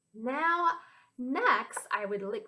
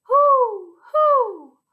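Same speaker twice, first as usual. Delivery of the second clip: high, loud owl hoot; 12 semitones higher, 11 dB louder than usual. Pretend. Teacher showing children what an owl says, high hooting. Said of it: "Hear that funny sound?".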